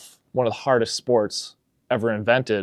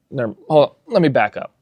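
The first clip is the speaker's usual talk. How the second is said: ordinary talk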